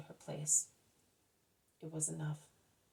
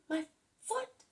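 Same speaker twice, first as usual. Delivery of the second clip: much higher, near a shriek of joy; the second cry climbs even higher; a narrated joyful cry, far higher and louder; ecstatic shout; 13 semitones higher, 4 dB louder than usual. A narrator's reading. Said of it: "he shouts and shouts until he becomes hoarse".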